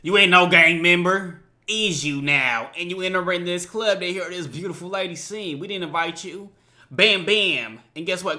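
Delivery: ordinary delivery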